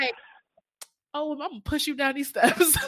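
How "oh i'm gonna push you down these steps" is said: laughing mock threat.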